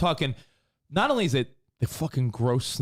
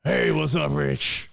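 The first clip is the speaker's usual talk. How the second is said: imitating Stone Cold; 2 semitones higher, much slower than usual. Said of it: "Three sixteen says".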